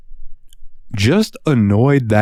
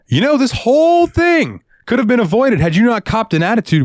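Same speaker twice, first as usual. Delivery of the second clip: higher, indignant retort; mock scolding; 14 semitones higher than usual. Cashier retells telling off a rude customer in a higher voice. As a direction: tell her off with mock indignation. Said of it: "And I said".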